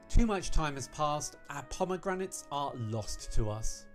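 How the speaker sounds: loud, theatrical outrage over a defeat; grand, punchy indignation; indignant pomegranate commander. Acted. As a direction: declaim the defeat like a melodramatic war leader. Voices defeated character lamenting pomegranates.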